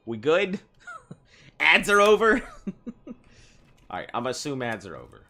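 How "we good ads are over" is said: giggly high question; asking through a laugh. Real, not acted. Checking if ads ended.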